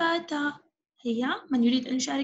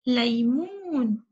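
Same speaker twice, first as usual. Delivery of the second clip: slow modelled word; slower than usual. Shown as a demonstration. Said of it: "ما هذا الشكل الأصفر".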